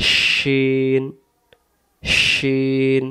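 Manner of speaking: "শিন" with a hushing sh and long held vowel; sh from mid-tongue. Shown as a demonstration. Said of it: "জিহ্বাটার মাঝখান থেকে ভেসে আসবে". ("শিন" জিহ্বার মাঝখান থেকে, লম্বা টেনে)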